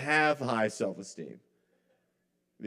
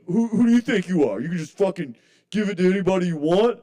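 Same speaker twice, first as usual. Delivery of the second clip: imitating a rude comic, higher and louder; angry yell; 7 semitones higher, 7 dB louder than usual. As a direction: bark it like an offended bully comedian. Rude comic yelling at him.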